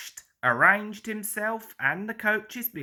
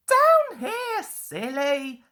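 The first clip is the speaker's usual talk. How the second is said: high, louder teasing engine voice; laughing Thomas; 10 semitones higher, 3 dB louder, slower than usual. A narrator's reading. Thomas teases Toby for looking up, high teasing voice. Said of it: "laughed Thomas".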